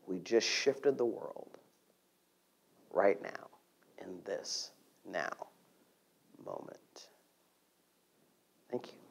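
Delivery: tender quiet closing words; soft whisper. Real, not acted. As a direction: let it fade to a hushed whisper.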